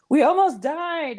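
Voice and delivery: high, slow, hammed-up horror; overacted near-death panic; mock dramatic fear. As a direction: play the traumatized birth story.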